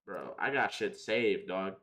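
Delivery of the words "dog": ordinary talk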